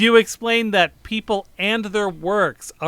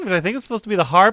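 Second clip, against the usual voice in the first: usual speaking voice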